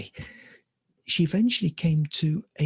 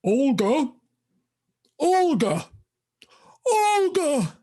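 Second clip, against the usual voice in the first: high, loud posh squirrel calling; plummy, high-pitched hail; 12 semitones higher, 4 dB louder, slower than usual. Acted. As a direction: call out grandly, rising higher each time. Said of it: "posh voice she heard".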